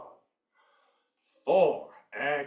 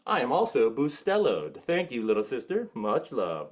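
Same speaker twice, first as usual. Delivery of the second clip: ordinary delivery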